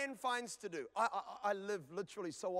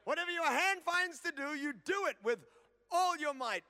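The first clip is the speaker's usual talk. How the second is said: higher, louder nagging mum chant; sing-song it at the kids like a mum over the dishes; mum nagging at dishes time; 7 semitones higher, 5 dB louder than usual. Retold or imitated. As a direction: mum repeating her motto over the sink. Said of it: "Mum will be in there going".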